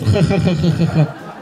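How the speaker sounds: short staccato "kha-kha-kha" cackle; mock throaty Russian laugh; hammed-up doctor's chuckle in a row of "kha"s. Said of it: "the weird Russian doctor I had".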